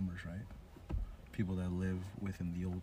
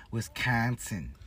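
slow, louder, higher Midwestern "Wisconsin"; Midwestern accent; 4 semitones higher, 5 dB louder, slower than usual. Acted. Mimicking how Wisconsin people talk, drawn-out Midwestern accent. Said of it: "That's how they talk".

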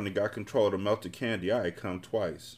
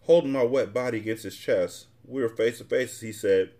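ordinary delivery

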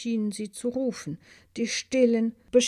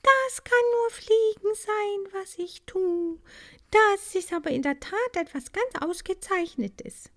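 high, breathless delight; thrilled little bee; 11 semitones higher, 4 dB louder than usual. A narrator's reading. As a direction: bubble over with wonder. Maya thrilled in flight.

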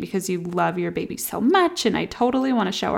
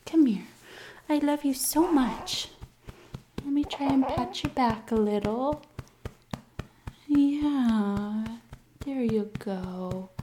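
higher, tender cooing; 4 semitones higher, 5 dB quieter than usual. Real, not acted. Mom coos lovingly while patting her baby's back.

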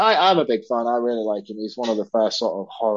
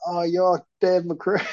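drawn-out, higher-pitched mock greeting; mock drunken recognition; 9 semitones higher, much slower than usual. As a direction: stretch it out high, like a tipsy fan spotting someone. Retelling his drunken mistaken greeting.

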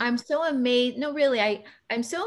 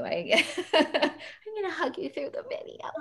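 laughing; 8 semitones higher than usual. Real, not acted. Touched, laughing at her emotion.

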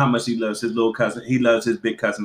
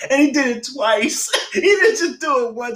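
high laughing retelling; 11 semitones higher than usual. Real, not acted. Friend laughing as she retells the nephew's joke. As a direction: laugh through the words.

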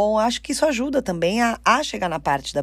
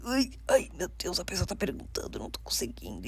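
panicked inner whisper, fading away; hushed nervous self-talk; anxious whisper; 10 dB quieter, faster than usual. Retold or imitated. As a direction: whisper it like you're losing your nerve. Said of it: "você vai falar assim".

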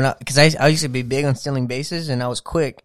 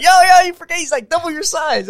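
high, loud alarmed warning from the guys; teammates warning; 14 semitones higher, 5 dB louder than usual. Retold or imitated. Retelling teammates holding him back, alarmed high warning. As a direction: yelp it like a friend pulling you back.

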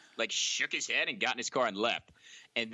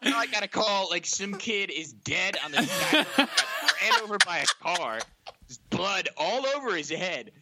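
raised, emphatic retold rant; screaming rookie cop; 5 semitones higher, 5 dB louder than usual. Rookie cop explaining the dead-kid call, yelling. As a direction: yell it as an alarmed officer. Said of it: "Screaming at me".

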